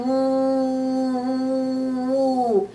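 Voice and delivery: soft breathy whoo of the wind; long, hollow wind sound. Retold or imitated. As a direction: blow it out like a gusting wind. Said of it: "he could hear the wind going".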